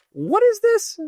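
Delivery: What is this? jumps way up in disbelief; baffled squeak. Real, not acted. Host stunned by a historian's tangled sentence, high disbelieving squeak.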